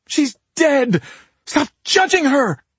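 very high, loud anguished shriek. A narrator's reading. Claudia defends her dead sister to Nick, shrieking. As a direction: shriek it in grief and outrage. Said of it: "Claudia shrieked".

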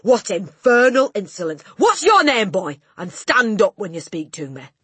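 loud, high, outraged tirade; shouting Trunchbull. A narrator's reading. Headmistress rebuking a cheeky pupil, outraged shouting. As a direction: thunder indignantly at full volume. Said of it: "shouted the Trunchbull".

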